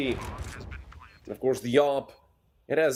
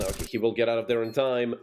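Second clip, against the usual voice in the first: ordinary talk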